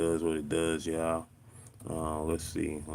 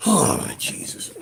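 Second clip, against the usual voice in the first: exasperated whisper; 11 semitones higher, 7 dB louder, slower than usual. Real, not acted. Exasperated after finding the problem.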